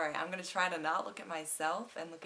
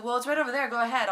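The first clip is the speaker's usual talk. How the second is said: louder and higher, breezy pointing the way; pushy salesman; 7 semitones higher, 9 dB louder, faster than usual. Retold or imitated. Retold salesman sending her to men's shoes, loud and breezy. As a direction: wave her over cheerfully. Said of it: "He said".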